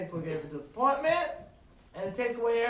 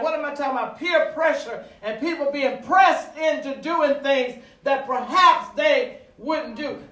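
loud, higher sermon delivery; raised, emphatic preacher's voice; forceful preaching; 6 semitones higher, 8 dB louder than usual. Real, not acted. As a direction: press the point home from the pulpit.

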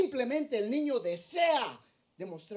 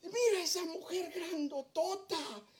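put-on higher childlike voice, amazed; toddler daughter; 5 semitones higher, slower than usual. Father imitating his little daughter pointing out a big woman, higher and childlike. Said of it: "ella dijo".

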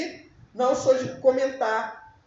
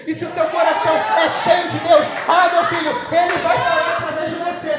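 higher, much louder fiery sermon; pregação inflamada; full-force preaching; 4 semitones higher, 8 dB louder than usual. Acted. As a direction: shout it to the church.

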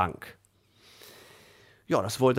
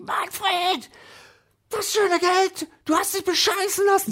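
furious high-pitched screaming at her husband; mother screaming; 4 dB louder than usual. Retold or imitated. Wife screams at husband over lost money, furious high shriek. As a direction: shriek it at him, beside herself. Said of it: "die hat voll rumgeschrien".

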